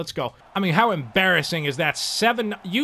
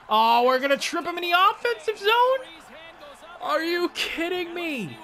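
exasperated outrage; 12 semitones higher than usual. Real, not acted. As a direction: rage at the teammate's blunder. Teammate trips in offensive zone.

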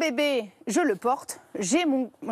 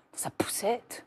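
whisper; 2 semitones lower, 7 dB quieter, slower than usual. Acted. Aside about the stroller.

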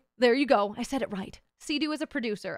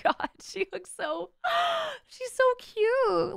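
gushing; 10 semitones higher, much slower than usual. Real, not acted. Cooing over cute picture.

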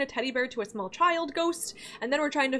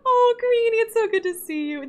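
much higher and louder, delighted; gushing greeting; 10 semitones higher, 7 dB louder than usual. Real, not acted. Greeting the companion again.

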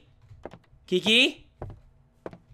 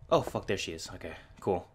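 hushed, scared; 11 semitones lower, 12 dB quieter than usual. Real, not acted. Hiding, very quiet.

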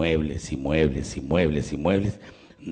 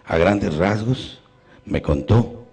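normal talking voice

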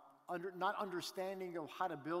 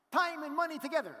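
higher, louder excited voice of a friend; imitating a thrilled friend on the phone; 9 semitones higher, 9 dB louder than usual. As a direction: burst out like you've struck gold. Friend's excited phone call retold.

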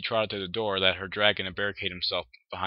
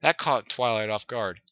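ordinary delivery, nothing marked

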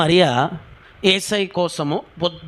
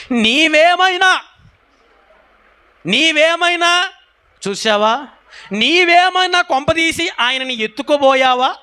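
high, drawn-out plea; anguished pleading; 10 semitones higher, 3 dB louder than usual. Acted. Mary asks the supposed gardener about Jesus's body, pleading desperately. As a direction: stretch each question out high and insistent.

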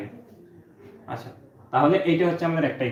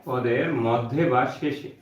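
slow, lower dictation pace with long gaps; 3 semitones lower, 4 dB quieter than usual. Acted. Teacher dictates the second rule to students in a slow dictation voice. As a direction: read the rule out slowly so the class can write.

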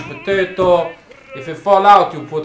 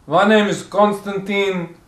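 Russian TV chef with slow, deliberate delivery; comic Russian accent, drawn-out name; put-on Russian accent. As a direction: introduce a cooking show in a thick Russian accent.

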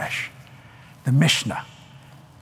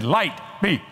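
God's command; 3 semitones higher than usual. Pretend. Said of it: "He said".

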